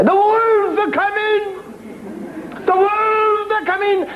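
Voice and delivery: very high, slow wailing alarm; panicked cry. Acted. Villagers warn everyone at the inn, high panicked wail. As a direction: wail the warning like the wolves are at the door. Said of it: "the same cry".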